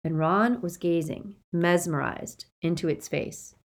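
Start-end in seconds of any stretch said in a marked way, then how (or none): none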